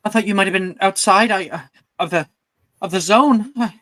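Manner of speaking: worried. Acted. Gemma tells friend she was worried.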